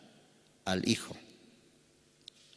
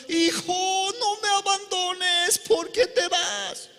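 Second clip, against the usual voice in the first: much higher, slow, pleading lament; crying mother; 4 dB louder, slower than usual. Acted. Mother partridge crying as her chick flies away, high and slow. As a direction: wail like a mother losing her child. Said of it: "La mamá perdiz está llorando".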